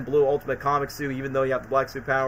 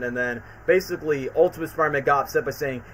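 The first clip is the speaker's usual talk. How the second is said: ordinary delivery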